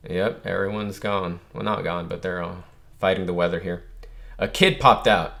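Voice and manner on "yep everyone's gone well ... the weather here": normal talking voice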